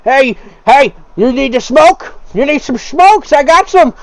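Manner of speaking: high, loud eager hustler voice; pushy smoke peddler. Acted. Patron looks down at the fallen stranger, high eager offer. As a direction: press the offer on him like a street hustler. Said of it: "and he's like".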